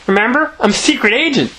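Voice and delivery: put-on high, proud child voice; beaming little girl. A narrator's reading. Greta reminds Bill of her role, high beaming voice. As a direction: announce it gleefully, high-pitched. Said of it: "she beamed".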